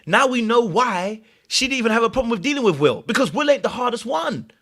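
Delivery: excited gossip. Real, not acted. High sing-song gossip to viewers about who is the maddest.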